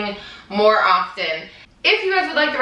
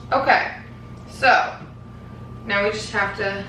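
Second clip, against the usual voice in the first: normal talking voice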